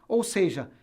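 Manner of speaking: ordinary talk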